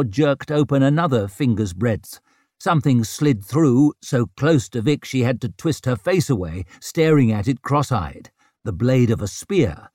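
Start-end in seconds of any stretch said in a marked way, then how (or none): none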